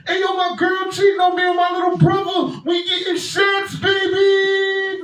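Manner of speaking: very loud, high club DJ yelling over music; DJ hype shout. Retold or imitated. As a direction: hype the crowd like a DJ. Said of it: "All I hear is".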